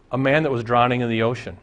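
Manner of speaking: usual speaking voice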